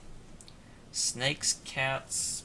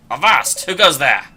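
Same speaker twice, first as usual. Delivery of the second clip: loud pirate bark; loud gruff-free pirate hail; pirate challenge; 2 semitones higher, 11 dB louder than usual. Acted. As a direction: call out like a sky pirate on watch. Pirate guard challenging intruders.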